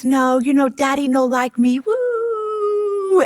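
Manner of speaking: lightly voiced tipsy mother, ending in a high woo; deaf mother. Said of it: "she says".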